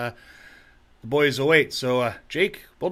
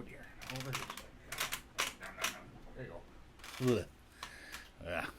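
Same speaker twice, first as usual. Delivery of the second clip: ordinary delivery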